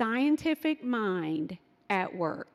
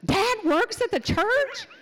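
high, loud, laughing disbelief; be stunned by old news; imitating a son's big surprise; amazed question; 10 semitones higher, 3 dB louder than usual. Son amazed to hear it.

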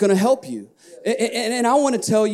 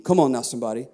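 ordinary talk, nothing marked

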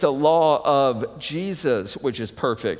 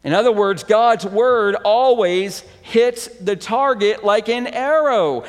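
rising, louder preacher's voice; preaching; 8 semitones higher, 6 dB louder than usual. Acted. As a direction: preach it out to the congregation, building. Preaching the arrow image.

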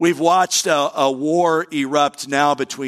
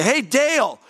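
higher, sharp call of a stern teacher; 10 semitones higher than usual. Retold or imitated. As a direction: call him out like his old teacher. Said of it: "She would say".

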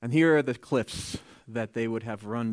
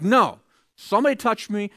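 insisting; 9 semitones higher, 9 dB louder than usual. Acted. Jesus insists.

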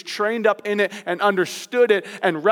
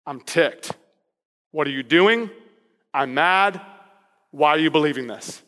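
low, exasperated scolding; Paul scolding the Galatians, mildly angry; mock angry Paul; 6 semitones lower than usual. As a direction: sound fed up with the church. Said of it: "Different tone, not happy".

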